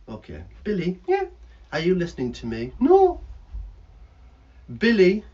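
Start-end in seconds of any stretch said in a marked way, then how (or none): 2.8-3.2 high, loud puppet voice; cheeky bird puppet voice; squeaky puppet bird. Acted.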